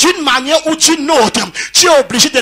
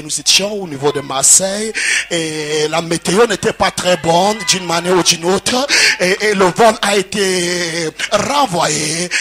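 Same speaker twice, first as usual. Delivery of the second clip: lower, put-on refined French accent, mocking; mock posh French accent; 6 semitones lower, 3 dB quieter than usual. Preacher mocking a blessed man whose accent changed, put-on posh French accent. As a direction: lower pitch, overdone polished French accent. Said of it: "mon accent a changé".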